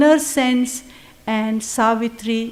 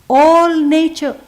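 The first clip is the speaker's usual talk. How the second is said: slow, louder, raised start of the verse; poem recital; 4 semitones higher, 6 dB louder, slower than usual. Acted. Teacher starting an adoration passage, slow and louder. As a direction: lift the opening words of the hymn. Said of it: "another passage".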